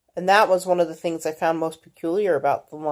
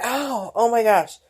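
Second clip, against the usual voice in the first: pained gasp; 5 semitones higher than usual. Retold or imitated. Flinching when daughter bumps her.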